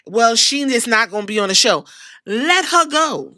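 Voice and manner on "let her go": drawn-out and slow; slow dismissive. Shown as a demonstration. Dismissing her slowly, unbothered. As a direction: let the words hang, slow. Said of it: "repeated dismissal".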